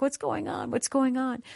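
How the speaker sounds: high, urgent asking; alarmed concern. Retold or imitated. Speaker retelling how he asked the weeping girl. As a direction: ask high and anxious. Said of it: "And I'm like".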